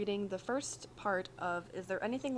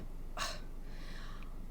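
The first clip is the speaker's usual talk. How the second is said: exasperated sigh; 6 dB quieter than usual. Acted. Virgil sighs in exasperation.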